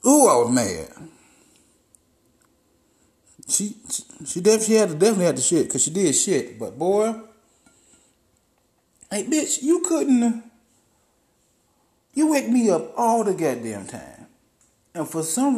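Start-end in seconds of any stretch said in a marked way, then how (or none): 0.1-1.1 mad. Real, not acted. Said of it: "I was so motherfucking mad". 9.1-14.3 mock scolding. Scolding the dog directly.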